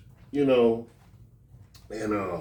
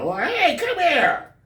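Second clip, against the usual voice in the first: hammed-up angry father; mock dad scolding; 14 semitones higher than usual. Father scolding his son in front of aunts, high.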